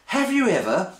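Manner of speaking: high mock voice, rising into a question; silly high-pitched imitation of a partner; put-on partner's voice. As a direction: lift it high for an exaggerated partner impression. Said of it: "she doesn't speak like that, I just like the voice".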